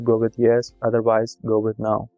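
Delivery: normal talking voice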